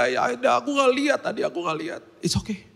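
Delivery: a hint of a kid fighting tears; tearful little boy. Son explaining to dad, lightly voiced teary child. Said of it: "Terus dia setengah nangis".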